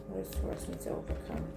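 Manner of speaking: barely audible whisper; whispered question. Real, not acted. Reader asking what Taurus must overcome, whispered. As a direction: whisper it to the cards. Asking the cards while shuffling.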